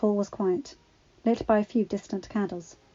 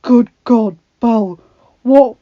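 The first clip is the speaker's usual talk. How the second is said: loud, alarmed, stumbling over the words; fearful question; 4 semitones higher, 17 dB louder, slower than usual. A narrator's reading. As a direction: ask in horror, pitch climbing at the end. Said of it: "he asked in fear".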